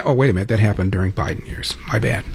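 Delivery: dropped to a soft, sheepish aside; mock self-correction. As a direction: back off quietly as if caught out. Feigned sudden self-correction after parody.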